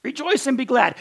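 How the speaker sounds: preacher's joyful outburst; exuberant rejoicing. Shown as a demonstration. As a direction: lift it up like good news. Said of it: "not aggressive and offended".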